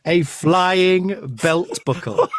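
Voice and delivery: real laughter, slow and high; laughing. Real, not acted. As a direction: crack up while repeating it.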